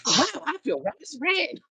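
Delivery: high-pitched, suddenly overjoyed; hammed-up drugged cheerfulness; mock all happy. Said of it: "He's all happy".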